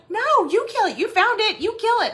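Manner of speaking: high, loud bickering refusal; hammed-up, insistent back-and-forth; retold squabble. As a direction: imitate a panicky husband passing the job back. Acted spouse quarrel over spider.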